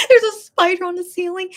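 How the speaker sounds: high, loud retelling through laughter; laughing plea. Retold or imitated. Asking cleaning lady about the spider, laughing retold plea.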